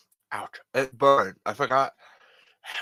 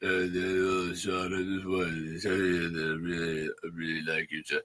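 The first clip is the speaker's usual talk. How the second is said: Stallone impression; 6 semitones lower, 3 dB quieter than usual. Stallone phoning the podcast hosts, in a lower Stallone voice. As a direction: do Sylvester Stallone confessing to the hosts. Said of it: "I was trying to do Stallone".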